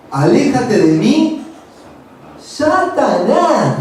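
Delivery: slow, drawn-out stern command; harsh rebuke. Retold or imitated. Jesus rebuking Peter, slow and stern. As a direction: lean hard on Satanás, slowly. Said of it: "muy duro fue".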